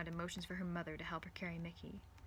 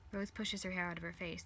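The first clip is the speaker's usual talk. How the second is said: usual speaking voice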